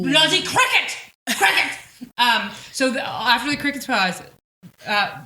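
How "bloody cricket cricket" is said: high, loud hammed-up British "Bloody cricket!"; a pompous British lord shouting at crickets; mock British outburst. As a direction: bark it like an outraged British toff. Mock British nobleman exclaiming.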